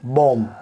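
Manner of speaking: "बॉम्ब" said as "bom", ending on m; silent B. Shown as a demonstration. Teacher models the correct reading, "बॉम्ब" as "bom", B silent. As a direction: say "बॉम्ब" with no final b sound. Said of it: "बी जो है वो silent है".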